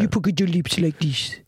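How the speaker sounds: slow cartoon crab with a Jamaican-sounding lilt; put-on crab voice, drawn out in a Jamaican-style accent. Shown as a demonstration. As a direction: demonstrate the Jamaican-ish accent slowly. Demonstrating the Sebastian crab accent.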